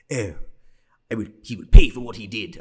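mocking a stiff fake British accent, loud; bad British accent. Mocking actress's accent to friend, hammed British. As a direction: ham up a terrible British accent. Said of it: "it's so bad".

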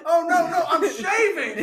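movie character warning about the toilet; Dumb and Dumber quote. Retold or imitated. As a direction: blurt the warning in a panic. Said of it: "that scene from Dumb and Dumber".

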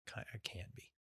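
breathy whisper to herself; whispered aside. Real, not acted. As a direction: whisper it under your breath.

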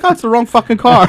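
high, sheepish dawning realization; sudden realization. Retold or imitated. To a laughing friend, realizing it's the wrong car, high sheepish surprise. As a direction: catch the embarrassing truth. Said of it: "I had a shit fit".

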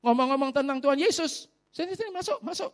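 high, eager invitation; lightly voiced uncle waving him in; welcoming uncle. (suara tinggi ramah mempersilakan masuk)

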